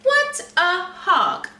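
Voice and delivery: a snorty, scornful pig; a hint of a snorting pig. A narrator's reading. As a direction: sneer it like a disgusted pig. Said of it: "snorted Hampshire".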